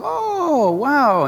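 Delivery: high, drawn-out ooh of wonder; wondering exclamation. Retold or imitated. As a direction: marvel aloud as if showing a small child pictures. Mother shows child the orphanage pictures.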